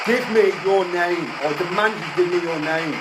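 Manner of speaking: loud, slow, high commands; commanding shout. Acted. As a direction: shout orders at the spirit. Demanding spirit's name.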